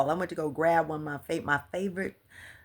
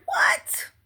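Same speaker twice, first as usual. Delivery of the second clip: shocked what; 4 dB louder than usual. Retold or imitated. Reacting to Spirit's command.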